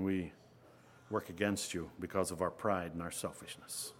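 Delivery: soft, earnest prayer voice, trailing off at the ends. Acted. Pastor praying over the congregation, soft and earnest.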